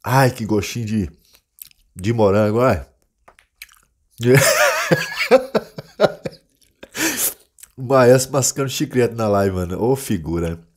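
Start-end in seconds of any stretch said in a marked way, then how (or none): 4.2-6.3 high laugh. Real, not acted. Risada alta sobre o chiclete. (risada alta e aguda)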